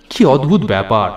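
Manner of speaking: ordinary delivery, nothing marked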